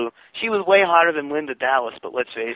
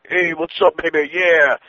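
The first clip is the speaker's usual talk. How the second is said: Tony Rumble; 2 semitones higher, slower than usual. Acted. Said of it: "Tony Rumble".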